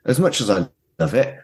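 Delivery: ordinary delivery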